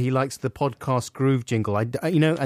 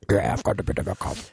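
voice changer; 6 semitones lower, faster than usual. Pretend. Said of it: "you've got your special voice changer thing".

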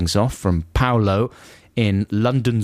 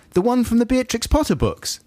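high-pitched quoted query; imitated puzzled question; 10 semitones higher than usual. Reading out girlfriend's question about Terry Nutkins, high and puzzled.

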